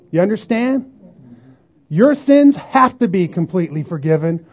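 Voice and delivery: normal talking voice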